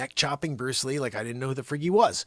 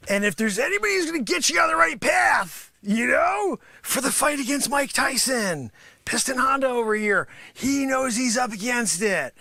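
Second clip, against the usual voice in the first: hyped sensei shout; 8 semitones higher than usual. Acted. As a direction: pump him up like a cocky martial arts master. Sensei character pumping up fighter.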